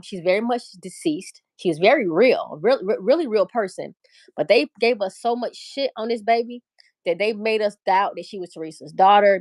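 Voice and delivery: ordinary talk, nothing marked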